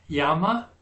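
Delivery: "yama" stressed on YA; YA-ma, light ending; final syllable unaccented. Shown as a demonstration. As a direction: leave the last syllable of "yama" unstressed. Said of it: "It doesn't get accent".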